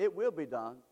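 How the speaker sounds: low-pitched, settled statement; low, matter-of-fact promise; low assurance. Real, not acted. First take, quiet assurance.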